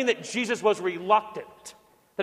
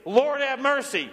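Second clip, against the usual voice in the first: pleading cry, pitched higher; 7 semitones higher than usual. Shown as a demonstration. Pastor showing the church's cry, raised calling out. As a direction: call it out to lead the congregation, short of yelling. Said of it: "We are to yell out".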